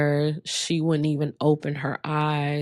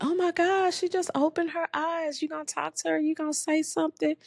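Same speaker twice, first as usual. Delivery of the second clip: high, bright imitation of a nurse; excited nurse; 12 semitones higher, faster than usual. Retelling the nurse at the bedside, a high excited imitation. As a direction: mimic the nurse's surprised chirp, much higher. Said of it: "the nurse is like".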